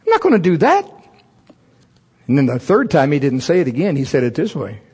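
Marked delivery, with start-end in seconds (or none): 0.1-0.8 high, loud stubborn no; mock defiant refusal; flat refusal. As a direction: dig in your heels. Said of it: "And I'm".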